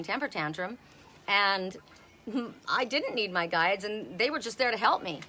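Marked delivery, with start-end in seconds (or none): none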